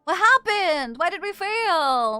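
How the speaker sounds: exasperated, drawn-out demand to know; dismayed outcry. Real, not acted. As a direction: cry it out, dragging the last word. Repeats louder after mission failed.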